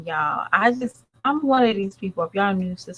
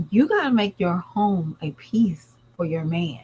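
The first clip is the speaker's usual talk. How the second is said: normal talking voice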